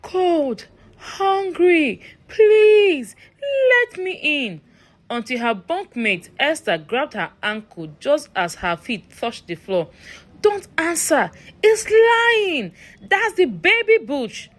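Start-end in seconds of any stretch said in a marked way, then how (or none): 0.0-4.6 high, pleading creature voice, not whispered but sung out; otherworldly high wheedling plea; eerie creature plea. A narrator's reading. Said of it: "The creature kept whispering". 10.4-14.5 high, tense warning to a friend; urgent frightened warning. A narrator's reading. Bunkmate grabs her, urgent warning.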